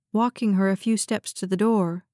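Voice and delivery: usual speaking voice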